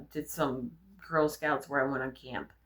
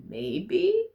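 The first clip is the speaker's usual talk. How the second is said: unsure maybe; 13 semitones higher, 3 dB louder than usual. Real, not acted. Wondering about trying spitting.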